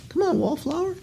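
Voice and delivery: very high, soft teasing coax; playful high-pitched nudge; coaxing squeak. Acted. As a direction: sweet-talk the shy bidder in a squeaky voice. Coaxing a shy bidder.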